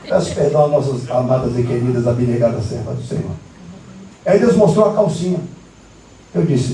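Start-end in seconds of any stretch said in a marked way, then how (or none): 0.1-3.3 low, hurried formal aside; low-pitched, deferential apology to the congregation; solemn apology. Real, not acted. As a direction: drop low for a ceremonious apology. Mock-solemn apology to the women.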